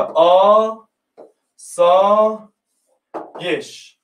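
each syllable said apart with pauses. Shown as a demonstration. Teacher sounding out آسایش slowly for students, syllable by syllable. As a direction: break the word into its syllables.

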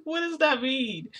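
high, drawn-out disbelief; incredulous question. Retold or imitated. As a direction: ask it in amused alarm. Laughing, retold alarmed question.

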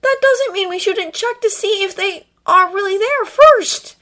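high, slow, loud insistence; firm, drawn-out objection; insistent. A narrator's reading. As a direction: press the point, pitched high. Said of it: "Draco insisted".

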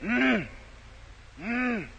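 faint dying moan, barely voiced; weak moan. Dying man moaning from his bed, a faint moan. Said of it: "He said".

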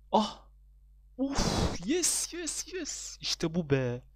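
loud, very high jubilant cries; elated outburst. Real, not acted. Gamer celebrating the lucky click, elated shouting. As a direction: explode with joy at a big win. Celebrates getting the wanted item.

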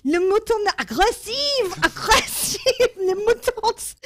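high, slow, laughing broken French; bad French. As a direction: stumble through French you barely speak. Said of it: "but my French is not good".

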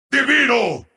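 short, contained exclamation; contained announcer call. Acted. Announcer declaring a player divine, contained. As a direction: announce it with some restraint.

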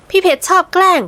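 put-on high, cheek-puffed girl's complaint; pouting sulk. Girl pouting at her teasing boyfriend, high sulky whine. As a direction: sulk at him with a pout. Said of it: "ทำแก้มป่องอย่างแง่งอน".

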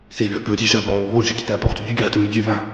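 soft, put-on wolf voice; wolf in disguise. A narrator's reading. Wolf posing as the girl at the door, softly feigning. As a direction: pose as the girl, keeping it soft.

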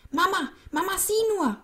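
put-on very high, loud excited boy; calling from afar. A narrator's reading. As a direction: shout for mum from a distance. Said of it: "rief er schon von weitem".

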